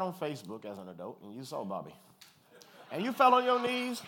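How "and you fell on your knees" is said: much higher, loud, overjoyed cry; big comic shout of relief; hammed-up shout. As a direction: shout it like a grateful fall to the knees. Hammed-up grateful collapse.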